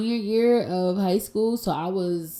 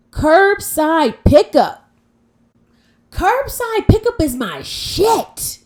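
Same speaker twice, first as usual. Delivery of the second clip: high, slow and excited; 11 semitones higher, 9 dB louder, much slower than usual. Real, not acted. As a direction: gush about it. Excited about curbside pickup.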